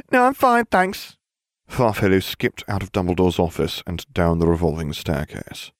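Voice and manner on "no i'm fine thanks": very high, quick, airheaded voice; squeaky airhead voice; ditzy blonde witch. A narrator's reading. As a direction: play the dim bubbly witch, pitched way up.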